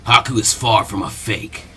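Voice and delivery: a hint of a cold mercenary; offhand villain. Zabuza brushing off Asuma's warning, offhand.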